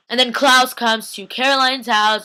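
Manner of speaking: ordinary talk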